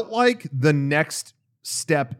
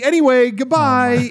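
higher, louder, slow sing-song farewell; chirpy robot assistant; 8 semitones higher, 6 dB louder, slower than usual. Retold or imitated. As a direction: wave the guest off like a bubbly AI. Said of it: "And I would always say".

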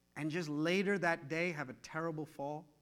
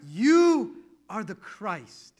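slow, louder solemn declaration; slow proclamation; 3 semitones higher, 10 dB louder, much slower than usual. Retold or imitated. Peter confessing to Jesus, slow and loud. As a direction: proclaim it slowly, in awe.

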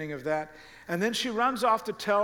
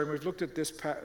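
usual speaking voice

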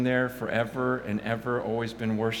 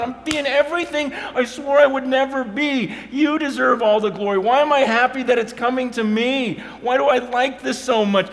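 anguished plea; 14 semitones higher, 10 dB louder than usual. Retold or imitated. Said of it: "I'm going".

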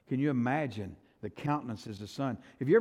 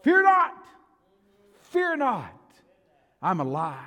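very high, loud shouted command; ringing shout, each time set apart; voice of Jesus; 12 semitones higher, 11 dB louder, slower than usual. Acted. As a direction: cry it out with divine authority. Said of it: "all you've got to do is hear his word".

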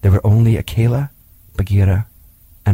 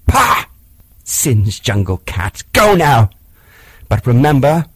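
scornful shout; 3 semitones higher, 4 dB louder than usual. Acted. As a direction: spit it at the fleeing tiger. Mowgli drives off the tiger.

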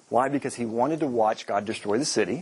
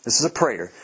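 ordinary delivery

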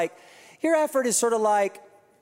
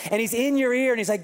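usual speaking voice

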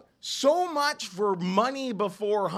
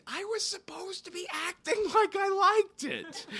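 whisper; 13 semitones higher than usual. Real, not acted. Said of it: "whispered aside".

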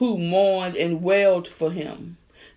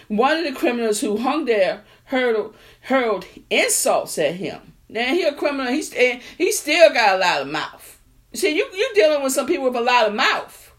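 usual speaking voice

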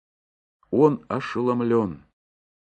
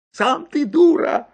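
squeaky retort; 5 dB louder than usual. Acted.

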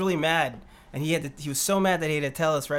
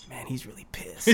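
whispered aside, very soft; retold whisper; 5 semitones lower, 10 dB quieter than usual. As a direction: whisper it to the guy next to you. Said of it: "and I whisper".

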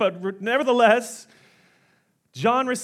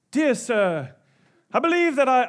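put-on British accent, higher and louder; 5 semitones higher, 4 dB louder than usual. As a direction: play a polite British parrot. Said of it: "he has a British accent".

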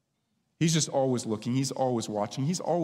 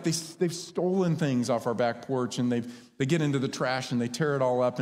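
usual speaking voice

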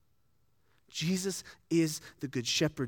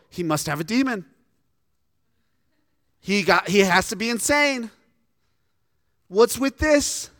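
mock outraged, building to a loud high "What's with this?"; baffled crowd member; 5 semitones higher, 6 dB louder than usual. Preacher voicing the crowd calling Jesus insane, mock outraged. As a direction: play a scandalised bystander. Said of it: "Some of them just go".

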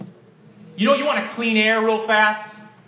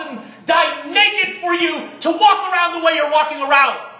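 loud, high, driving sermon voice; fiery preaching; 7 semitones higher, 5 dB louder than usual. Acted. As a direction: preach it out with full force. Preaching at full force.

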